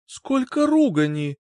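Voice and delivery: high, squeamish exclamation; disgusted exclaim. A narrator's reading. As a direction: wince as you say it. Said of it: "сморщилась Райк".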